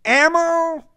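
high, loud cry of distress; distressed shout. A narrator's reading. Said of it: "a convulsed voice shouted in distress".

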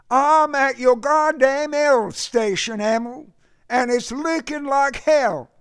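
high, loud Southern complaint; distressed shouting. A narrator's reading. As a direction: holler down the line in distress, Southern accent. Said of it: "a convulsed voice shouted in distress".

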